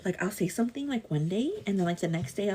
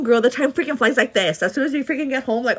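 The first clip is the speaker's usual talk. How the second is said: usual speaking voice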